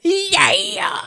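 mock delighted shriek; gleeful squeal. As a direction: cheer like a thrilled villainess. Imitating Vanica's delight.

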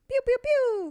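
high laser gun sounds; laser pew pew. Shown as a demonstration. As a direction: make laser blaster noises. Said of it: "No, like the air horn noise".

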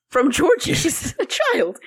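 loud, high angry warning breaking into a laugh. Retold or imitated. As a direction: snap it at him, then crack up. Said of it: "And she's just like".

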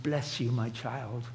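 put-on a hovering saint blessing a child; mock pious blessing. Preacher mocking aloof piety, in a soft slow saintly voice.

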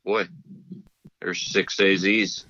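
ordinary talk

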